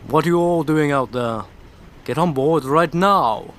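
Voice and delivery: bellowing order from the ship, slow on the command; booming call from the deck, dropping the accent; loud captain's shout. Acted. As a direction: yell them aboard like a gruff captain. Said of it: "Don't know where Sid's accent gone either".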